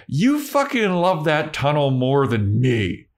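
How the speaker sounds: hammed-up clingy girlfriend; whiny Harmony. Harmony whines at Spike about the tunnel, high and drawn out.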